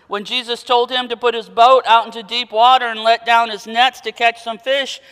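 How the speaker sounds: normal talking voice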